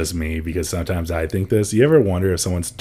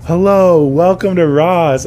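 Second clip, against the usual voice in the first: higher, louder, slow sing-song welcome; friendlier greeting; 9 semitones higher, 8 dB louder, slower than usual. Retold or imitated. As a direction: sound extra friendly to the customer. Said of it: "Be friendlier".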